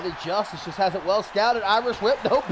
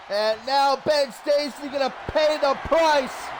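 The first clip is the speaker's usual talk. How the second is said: ordinary delivery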